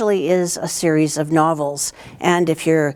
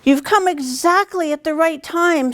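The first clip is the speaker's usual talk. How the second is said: Mrs Beaver's voice; 11 semitones higher than usual. Acted. Mrs Beaver welcomes the children.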